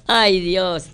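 ordinary delivery

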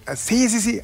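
fan agreeing. Retold or imitated. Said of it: "¿Me hablas a mí?".

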